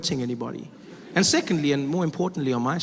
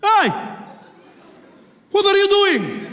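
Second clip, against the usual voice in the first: put-on high, loud accented passenger yell; shouted challenge; 7 dB louder, slower than usual. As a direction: call him out across the cabin. Imagined passenger challenges the bomber.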